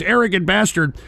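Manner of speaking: ordinary talk, nothing marked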